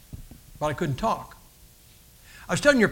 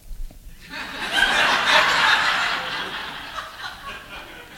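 long high breathy laugh imitated; high burst of laughter; wife's laugh. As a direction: mimic the wife bursting out laughing. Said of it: "She went".

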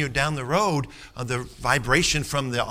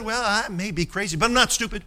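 a lightly voiced, higher inmate's smug punchline; inmate's comeback; 7 semitones higher than usual. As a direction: land the inmate's dry retort, a touch higher. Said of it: "He said".

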